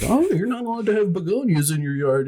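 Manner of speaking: high, put-on fussy rule-enforcer voice; mocking HOA busybody. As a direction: mimic a petty neighbor scolding about rules.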